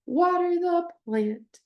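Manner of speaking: Water punched high and slow; stress on water. Shown as a demonstration. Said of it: "with stress added at the end of the sentence".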